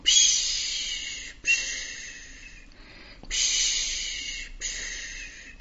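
gehauchtes, abebbendes Rauschen; geflüstertes Wellenrauschen. Acted. Wellengeräusch nachgeahmt.

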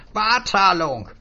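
a high, eager little stranger selling words; high, wheedling salesman voice. Acted. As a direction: pitch it up like a pushy little peddler. Stranger keeps shouting.

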